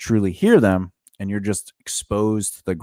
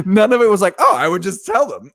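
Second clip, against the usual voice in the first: laughing mockery; 8 semitones higher, 5 dB louder than usual. Mocking laughing high voice.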